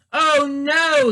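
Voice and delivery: high, loud gasp of dismay; a startled Oh no as his nose turns to a carrot; gasping alarm. Acted. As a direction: gasp it out in sudden shock. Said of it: "he gasped".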